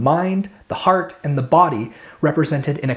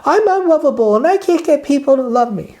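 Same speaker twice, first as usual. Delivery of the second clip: put-on high, loud crying-baby whine; whiny baby voice; 11 semitones higher, 5 dB louder than usual. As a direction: whimper it like a big baby. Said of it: "pretend like your little baby crying".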